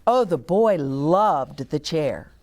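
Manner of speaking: ordinary delivery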